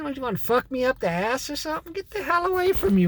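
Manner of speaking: ordinary delivery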